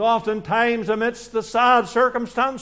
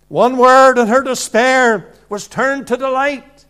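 loud projection; 3 semitones higher, 6 dB louder than usual. Real, not acted. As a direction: proclaim it, loud and full. Preacher proclaiming, raised voice.

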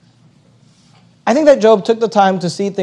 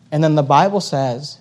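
ordinary talk, nothing marked

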